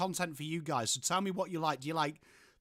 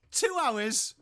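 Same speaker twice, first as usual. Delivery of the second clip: higher, louder mock indignation; mock outrage, first take; teasing; 6 semitones higher, 5 dB louder than usual. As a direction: rib the latecomer with a raised voice. Teasing viewer for lateness.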